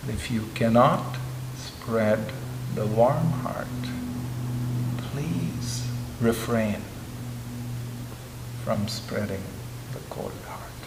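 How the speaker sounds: soft, slow, fading into a whisper; slow down and fade to a whisper on cold heart; quiet solemn quote. Retold or imitated. His Holiness's final advice, soft and slow to a whisper. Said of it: "he said one more thing".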